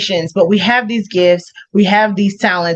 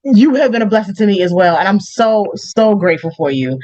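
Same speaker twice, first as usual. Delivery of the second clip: ordinary delivery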